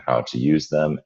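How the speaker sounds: usual speaking voice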